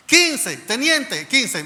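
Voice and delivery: high, loud urgent call. Retold or imitated. Alerting the lieutenant when number fifteen is called, calling out. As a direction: call out to get an officer's attention.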